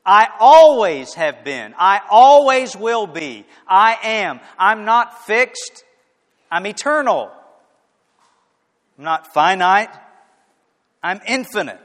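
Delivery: loud, high, fervent declaration of I am; Jesus proclaiming. Acted. Jesus declaring himself eternal to the crowd, loud. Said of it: "But Jesus said".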